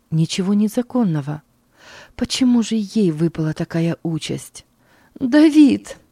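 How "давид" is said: high, loud anguished cry of the name; mother cries out her baby's name; near shout. A narrator's reading. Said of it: "почти кричала она".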